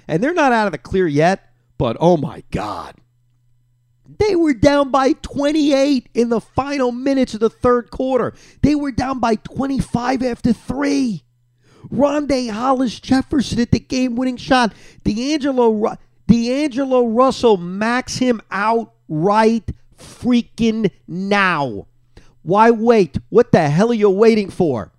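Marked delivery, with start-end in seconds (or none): 4.2-14.7 excited. Real, not acted. Celebrating the Nets' comeback win. 16.3-24.9 louder, higher, insistent challenge; loud demand. Real, not acted. Demanding a max deal for Russell.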